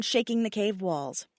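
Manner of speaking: usual speaking voice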